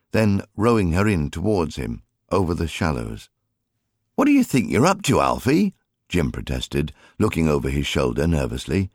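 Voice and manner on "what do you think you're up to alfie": put-on high, quick boy's voice; cheeky lad, voiced high; young Alfie. A narrator's reading. Child Alfie voiced by narrator.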